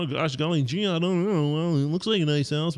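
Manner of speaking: mock meek "gosh, golly gee", hesitant and unsure; timid bumbler. A timid buyer hedging to the seller, mock meek voice. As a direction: play the nervous pushover, not Trump. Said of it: "He would walk in there and go".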